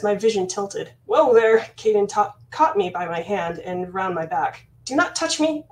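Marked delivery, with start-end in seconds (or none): 1.0-1.6 whoa there. Acted. Caden catches stumbling princess. 4.9-5.7 commanding. Acted. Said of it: "I commanded".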